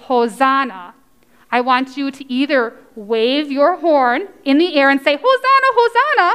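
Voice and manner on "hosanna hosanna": high, quick, chanting cheer; demonstrated cheer. Shown as a demonstration. Teacher showing children what to say, high excited cheer.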